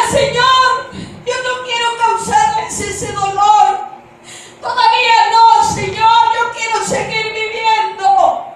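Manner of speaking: loud, high cry to God; desperate plea. Retold or imitated. Woman begging the Lord to let her live, desperate plea. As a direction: plead at full voice, drawing out the ends. Pleads with God not to die.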